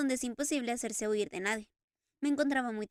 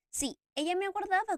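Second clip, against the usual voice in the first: raised, longing Phantom voice; Eric's voice, higher; 8 semitones higher than usual. Acted. Eric repeating to the Daroga, in a raised longing voice. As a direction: lift it into Eric's yearning. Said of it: "continuó Eric".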